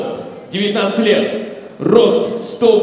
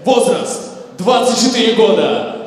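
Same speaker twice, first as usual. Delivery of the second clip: usual speaking voice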